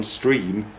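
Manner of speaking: usual speaking voice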